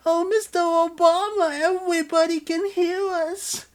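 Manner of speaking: squealing falsetto moan; high moaning lover. Acted. Lover character voice.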